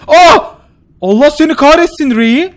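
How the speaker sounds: loud, high furious yell; angry yell. Real, not acted. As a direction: curse the game out loud. Karakter ölüyor. (öfkeyle bağırarak)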